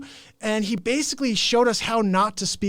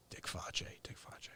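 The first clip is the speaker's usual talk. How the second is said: unvoiced hushed taunt; whisper; 24 dB quieter than usual. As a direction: breathe the name like a secret. Mocking name softly.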